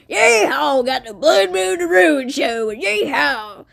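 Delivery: loud, high yeehaw holler; hammed-up cowboy show fanfare; mock cowboy whoop. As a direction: whoop it up like a rodeo announcer.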